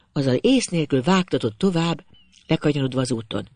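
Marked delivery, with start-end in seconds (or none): none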